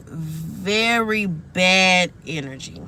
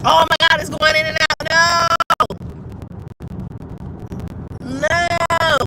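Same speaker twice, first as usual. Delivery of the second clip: alarmed outcry; 7 semitones higher than usual. Real, not acted. Telling viewers the stream is glitching, a high alarmed cry.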